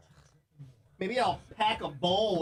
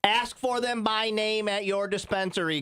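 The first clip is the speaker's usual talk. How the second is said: slow promo shout-out; ad read; 4 semitones higher, slower than usual. Acted. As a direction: plug the brand slowly. Plugging a sponsor on camera.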